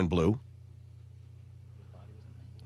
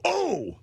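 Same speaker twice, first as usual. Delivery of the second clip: high, sharp imitated cry of pain; gunshot groan; 13 semitones higher than usual. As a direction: mimic a man hit by a bullet, a sudden high yelp. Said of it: "lean over and go".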